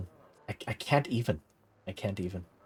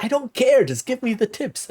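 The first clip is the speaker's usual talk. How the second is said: high-pitched, exasperated mock plea; impatient outburst; 12 semitones higher, 4 dB louder than usual. Reader begging article for the tips, mock exasperated. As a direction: cut in, pitched way up with comic impatience.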